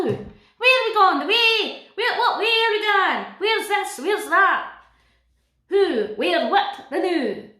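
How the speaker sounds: high, loud, broad Scottish Dora voice; Dora in full Scottish accent. Pretend. Dora speaking to Boots in a loud, high broad Scottish accent. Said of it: "Could you imagine that?".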